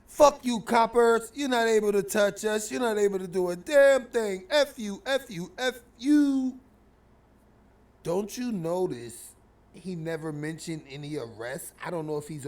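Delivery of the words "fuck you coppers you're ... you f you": loud, high, defiant mock yell; taunting shout. Armed kids taunting the cops, defiant shouting. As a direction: shout the police down with bravado.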